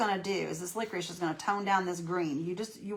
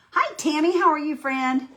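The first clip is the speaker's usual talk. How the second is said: cheerful greeting; 9 semitones higher, 10 dB louder than usual. Real, not acted.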